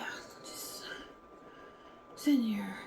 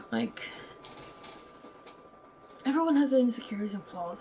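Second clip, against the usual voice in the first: normal talking voice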